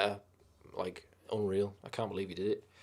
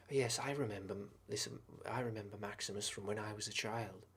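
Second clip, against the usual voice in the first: soft, mocking grown-up Lucius voice; a hushed mock movie-hero line; lightly voiced sequel Lucius, softer; 10 dB quieter than usual.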